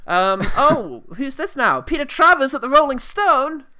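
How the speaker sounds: high proclaiming crier; town crier. Pretend. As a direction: announce it like a herald. Announcing like a crier.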